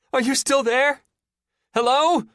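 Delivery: high, loud woman's call; scared woman calling. A narrator's reading. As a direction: call out anxiously.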